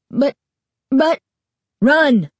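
high, loud frightened yell; screaming. A narrator's reading. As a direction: scream a warning. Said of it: "he screamed".

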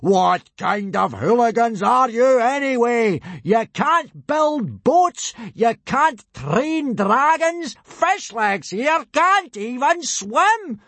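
high, loud, slow furious tirade; drill-sergeant dressing-down at full volume; roaring rage. A narrator's reading. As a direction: roar at the recruits, hammering every word. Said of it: "roared Gobber".

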